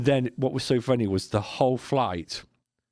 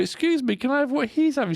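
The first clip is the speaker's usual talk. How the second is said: much higher, small polite request; mock timid wife; 4 dB louder than usual. Wife asks stewardess for the same treats, high meek. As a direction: ask meekly in a squeaky voice.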